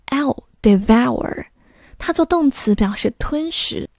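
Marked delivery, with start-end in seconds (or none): 0.1-1.4 devour with the L-like sound stretched and overdone, said on its own first; teacher exaggerating devour for the class; exaggerated pronunciation. Shown as a demonstration. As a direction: lean hard into the odd sound in devour, clearly demonstrating. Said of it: "一定要做得很夸张".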